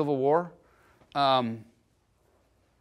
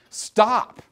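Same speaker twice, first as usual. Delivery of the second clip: higher, louder mock cry of a wounded man; wounded soldier's cry; 6 semitones higher, 6 dB louder than usual. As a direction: call out the dying soldier's plea. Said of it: "there's dudes laying around going".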